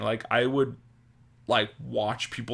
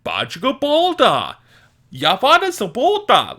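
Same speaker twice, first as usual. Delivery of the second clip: higher, louder nonsense Simlish babble; Sims gibberish; 10 semitones higher, 8 dB louder than usual. Retold or imitated. Toddler hearing adults as Sims characters, high chirpy gibberish. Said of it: "people just kinda sounded like Sims".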